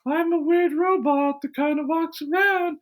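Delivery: high, thin-pitched weird robot voice; droning robotic character voice, pitched far up; Peter Isherwell. Acted. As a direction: impersonate an odd, inhuman tech guru. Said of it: "that's kind of a good impression".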